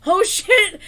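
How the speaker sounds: loud, high shocked outburst; mock shocked exclamation; freaked-out crowd. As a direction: imitate a crowd suddenly freaking out. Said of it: "everyone freaks out".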